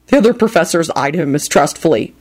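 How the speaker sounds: usual speaking voice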